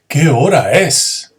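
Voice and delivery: slow, loud amazed exclamation; teacher demoing astonishment; astonished. Shown as a demonstration. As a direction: sound stunned at the hour.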